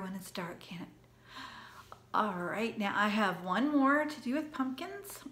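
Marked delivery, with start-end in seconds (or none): 0.0-0.9 whisper. Acted. Spooky hush about dark.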